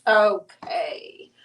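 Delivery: ordinary delivery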